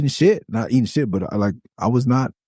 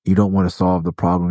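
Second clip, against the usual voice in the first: ordinary talk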